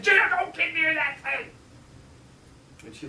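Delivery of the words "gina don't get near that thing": high, louder, worried plea; anxious warning. Retold or imitated. Little brother begging Gina to stay back, anxious. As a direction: warn her off, scared. Boy warns his sister.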